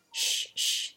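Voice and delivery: soft hush to keep quiet; hushing whisper. Acted. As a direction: shush the others gently. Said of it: "they said to each other".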